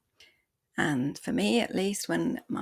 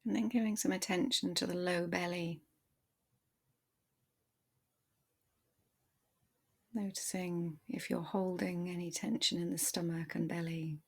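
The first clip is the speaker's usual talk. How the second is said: calm body-scan voice, softer than the intro; guided meditation; 4 dB quieter than usual. Acted. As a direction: ease the listener into their body, gently.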